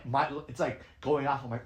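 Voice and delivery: ordinary delivery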